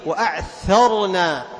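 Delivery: loud, slow وأعثرنا, ث held apart from the ر; emphatic recitation demo; clear tha. Shown as a demonstration. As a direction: call out وأعثرنا, ث whispered. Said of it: "تبين أيضًا الثاء".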